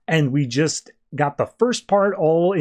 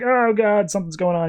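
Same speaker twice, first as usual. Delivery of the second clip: mock pain; 7 semitones higher than usual. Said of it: "doubling over in pain".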